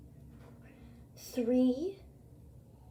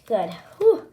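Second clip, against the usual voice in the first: usual speaking voice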